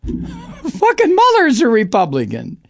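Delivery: high, laughing scorn; incredulous laughing jab; laughing disbelief. Real, not acted. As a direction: laugh it out at the absurdity. Laughing at Trump's tweet.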